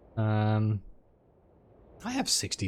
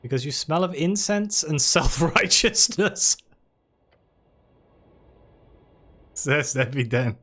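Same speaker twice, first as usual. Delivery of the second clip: put-on haughty frog with a French accent; snooty French-accented frog; 3 dB louder than usual. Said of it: "It's a French frog!".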